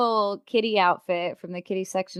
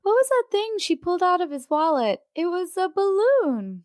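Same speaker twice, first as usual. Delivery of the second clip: family question-and-answer; 6 semitones higher than usual. Acted. Family discussing the wallet scene on the ride home, playacted question-and-answer. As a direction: play a lightly voiced awkward family exchange.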